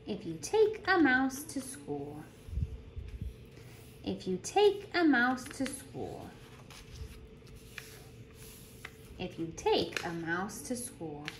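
lively storytime voice, bouncing pitch; animated picture-book reading; reading to children. Acted. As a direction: read it like a teacher at story circle. Reads picture book aloud.

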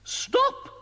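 sudden high shouted stop; a queen shrieking stop at the wizard. A narrator's reading. Queen stopping Mister Ben's spell, high sharp shout. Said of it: "shouted the queen".

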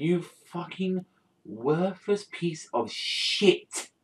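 high, slow, seething outrage; outraged insult. Acted. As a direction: spit each word slowly at a hated villain. Acted insult hurled at the Chancellor.

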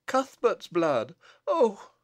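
slow horror rising to a high "oh"; horrified cry. Acted. Bess recognises the blood on her infant, horrified. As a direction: gasp out the discovery in dismay.

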